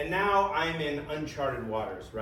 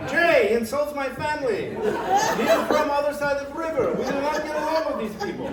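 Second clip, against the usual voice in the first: loud, high mock-Ukrainian indignation; fast, hammed-up Ukrainian outrage; indignant Ukrainian accent; 12 semitones higher, 7 dB louder, faster than usual. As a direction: protest hotly in a fake Ukrainian accent. Imagined outburst as fake Ukrainian Jan.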